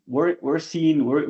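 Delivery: ordinary delivery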